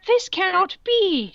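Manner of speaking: high, slow, indignant shout; shrill angry outburst, drawn out. Acted. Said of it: "shouted angrily".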